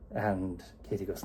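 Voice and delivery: ordinary talk, nothing marked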